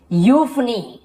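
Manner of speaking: यूफनी beginning with plain yu, stressed; correct यू onset. Shown as a demonstration. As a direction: model यूफनी as yuf-nee. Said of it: "यू बस बोल रहे". (यूफनी की शुरुआत सिर्फ़ यू से)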